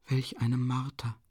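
slow, drawn-out cry of torment; anguished lament. A narrator's reading. Sonja lamenting over his suffering, anguished. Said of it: "entrang sich Sonja die qualvolle Klage".

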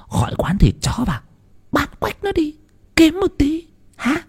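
put-on high old woman plotting to sell the dog; scheming old wife. Bà vợ bày kế bán chó. (giọng bà già cao, tính toán)

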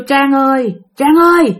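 repeated high-pitched name call. Retold or imitated. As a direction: mimic an unseen voice calling her name. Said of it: "cứ kêu".

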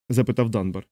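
plain reading voice

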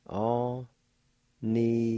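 Anitali drawn out very slowly, syllable by syllable; slow demonstration. Shown as a demonstration. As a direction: stretch every sound of Anitali for the learner. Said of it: "It's pronounced like this".

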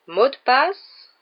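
très lent, plus aigu et plus fort, voix de portrait; étire la question comme une vieille dame peinte; grosse dame du portrait. Acted. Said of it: "demanda le portrait". (le portrait interroge les élèves, voix traînante)

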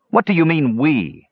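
indignant pushback, lightly growling; growled. A narrator's reading. Tannen rejects the dwarf's "we," mildly growling. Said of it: "Tannen growled".

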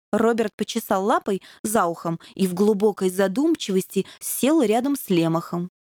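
usual speaking voice

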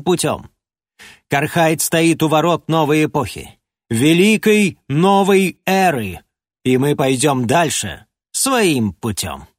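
a stern, scornful king; lightly voiced proud ruler, disdainful. King speaks to envoy. (надменный король)

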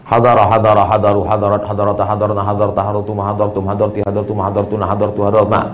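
low monotone recitation without pauses; rote conjugation drill. Shown as a demonstration. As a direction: rattle the forms off like a memorized chant. Rattles off Arabic verb conjugation.

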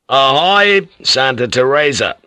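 loud, high ship's hail; calling out. Acted. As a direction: hail a ship across the water. Hailing a ship across the sea.

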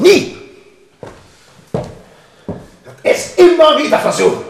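angry outburst. Acted. King raging over the countess's defiance. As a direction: burst out in fury.

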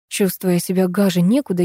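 ordinary delivery

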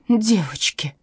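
слабый жалобный стон; простонать ещё жалобнее. A narrator's reading. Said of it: "простонала Катерина". (страдальчески, со стоном)

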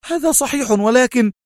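very high, tense protest; nervy exclamation. A narrator's reading. Mona protesting to the consul before he interrupts, nervily. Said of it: "هتفت في عصبية".

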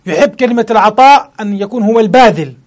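ordinary delivery

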